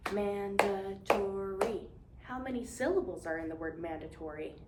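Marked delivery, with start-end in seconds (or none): 0.1-1.9 very slow, each syllable split apart; drawn-out syllable count; slow syllable clapping. Shown as a demonstration. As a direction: clap it out slowly, syllable by syllable.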